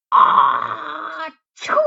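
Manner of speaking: teacher acting out the bear's sneeze; big sneeze. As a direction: burst out a cartoon sneeze. Bear sneezes in the story.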